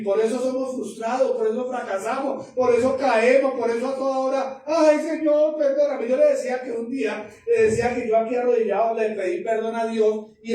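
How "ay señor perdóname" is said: raised, acted cry for forgiveness; mock penitent plea. Preacher imitates repeat sinners praying to God, pleading.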